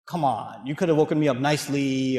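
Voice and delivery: low-pitched imagined grumble; understated wounded grievance; mild complaint. Retold or imitated. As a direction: protest mildly, voice dropped low.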